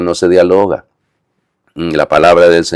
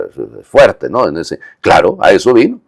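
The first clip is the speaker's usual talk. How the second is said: ordinary delivery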